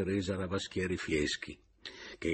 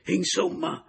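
higher, louder sharp cry; shout; 11 semitones higher, 6 dB louder, slower than usual. A narrator's reading. Adriana breaks free from Papiano, shouting. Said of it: "gridò Adriana".

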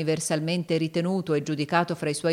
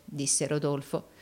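ordinary delivery, nothing marked

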